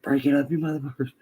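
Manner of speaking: high, strained order; re-enacted shout to break up a fight; mock angry command. Acted breaking up a dogfight.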